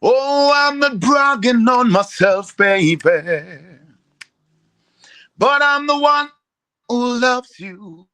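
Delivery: loud, high spoken soul ad-lib; preacher-style soul ad-lib. Shown as a demonstration. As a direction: talk it like a soul crooner. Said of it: "I wanna hear it sing it".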